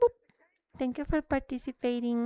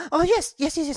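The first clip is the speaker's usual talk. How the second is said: excited; 5 semitones higher, 4 dB louder than usual. Real, not acted. Excited win in cooking game.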